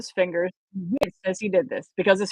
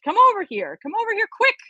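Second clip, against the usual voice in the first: climbing higher, urgent come quick; urgent call; 11 semitones higher, faster than usual. Acted. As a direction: pull him over fast. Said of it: "Johnny yelled".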